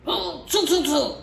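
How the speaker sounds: high, playful mocking monkey tsk-tsk; monkey chatter. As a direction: mimic the peddler like cheeky monkeys.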